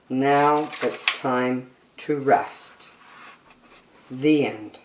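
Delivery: bright, lilting picture-book read-aloud; reading to children. Acted. Reading an animal picture book to young children, bright and lilting. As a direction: read it like a bedtime nature book for kids.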